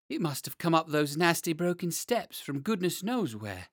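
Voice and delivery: trembling. Acted. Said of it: "trembling all over".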